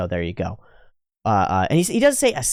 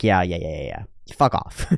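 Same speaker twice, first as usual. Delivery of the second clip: mock dismissive brush-off; 7 semitones lower than usual. Dismissing the arrogant mage.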